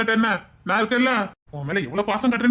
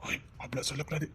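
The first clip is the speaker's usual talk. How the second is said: much softer, hushed soothing; soft coaxing; 5 semitones lower, 15 dB quieter than usual. Acted. Boyfriend soothes crying girlfriend, softly coaxing. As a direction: coax her gently out of it.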